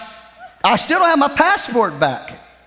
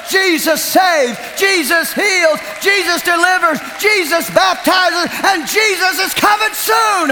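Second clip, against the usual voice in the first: preaching at full force; 7 semitones higher than usual. Real, not acted. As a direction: proclaim each line like a banner.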